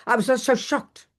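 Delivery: normal talking voice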